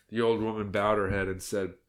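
plain reading voice

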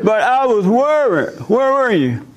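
high, pressing accusation; insistent wife. Retold or imitated. Wife presses husband about where he was, high and accusing.

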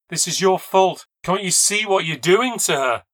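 loud, high-pitched accusation; angry outcry. Acted. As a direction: cry out in anger, pleading to be understood. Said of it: "getting very angry ... I cried".

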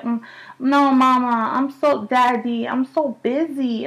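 put-on high, slow whiny little-girl voice; imitating toddler. Mother imitating her toddler daughter Zora, high and whiny. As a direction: play the busy daughter brushing mama off. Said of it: "she's like".

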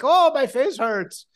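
high, drawn-out slapstick complaint; slapsticky comedy. Acted. Actor demonstrating slapsticky comedy to the co-host, hammed-up slapstick. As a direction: ham it up like a slapstick comic. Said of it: "like, slapsticky comedy".

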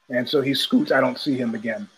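ordinary talk

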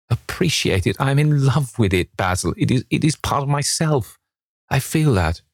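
high-pitched, fervent outpouring; passionate declaration. A narrator's reading. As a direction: pour out your love, pitched well up. Dorian passionately declares love for the portrait.

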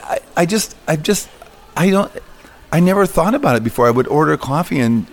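lightly voiced sheepish cart owner; flustered customer. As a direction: tumble over the words, caught off guard. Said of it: "And she goes".

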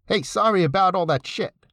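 put-on New York accent. Neighbor consoling after a mother's death, New York accent. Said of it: "in his unmistakable New York accent".

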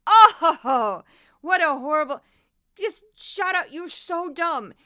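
loud, rising burst of exasperation that boils over; exasperated outburst. Real, not acted. Host ranting at Jesse Watters' clip, exasperated and loud. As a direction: shout at the screen in disbelief.

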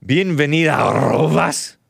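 "Bienvenida, arrobas" with a hard-rolled R; playful Spanish pronunciation. Shown as a demonstration. Trying out a Spanish greeting, "arrobas" with exaggerated trill. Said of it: "Is that how they say it?".

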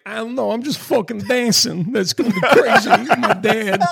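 high-pitched mock child chatter; high, carefree pretend-child voice; imagined kid voice. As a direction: put on a hammed-up little kid. Imagines the child talking at school.

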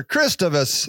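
ordinary talk, nothing marked

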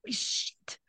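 muttered unvoiced "Shit"; whispered curse. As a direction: whisper it under your breath in dismay. Doctor discovers mistake.

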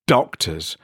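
ordinary delivery

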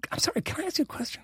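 high, soft, quick polite question; imitated polite stranger. Woman beside him asking politely, high and soft. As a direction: mimic her timid approach in a small high voice.

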